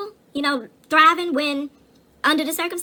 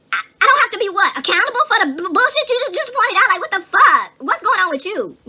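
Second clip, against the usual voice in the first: higher and louder, exasperated disbelief; indignant; 6 semitones higher, 4 dB louder than usual. Real, not acted. Ranting to a friend about people deflecting, loud exasperated. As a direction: push back hard, incredulous.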